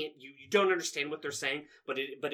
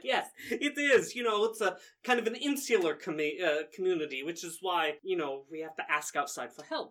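a hint of a higher, cheerful villager; lightly voiced Ericles; 6 semitones higher, 4 dB louder than usual. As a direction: play the friendly host, a little higher.

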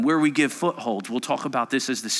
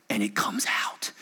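whisper; 6 semitones lower, 5 dB quieter than usual. Acted.

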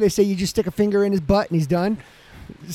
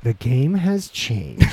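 dramatic trailer voice; 9 semitones lower, slower than usual. Pretend. Dramatic announcer line in banter.

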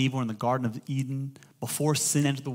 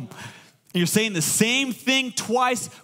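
usual speaking voice

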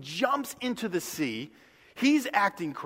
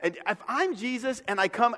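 ordinary talk

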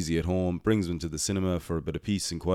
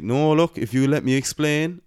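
pleading protest; 9 semitones higher, 6 dB louder than usual. Retold or imitated. Pleading with the angry mother.

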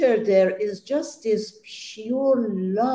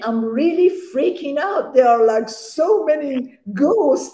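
freaked out; 6 semitones higher, 3 dB louder than usual. Retold or imitated. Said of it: "I said".

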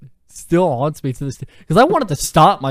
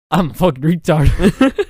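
laughing; 8 dB louder, slower than usual. Real, not acted. Laughing while quoting self.

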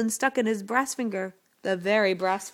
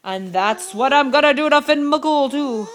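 loud, boastful giant; threatening giant; 7 dB louder than usual. A narrator's reading. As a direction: brag and threaten like a bully. Giant threatens her husband.

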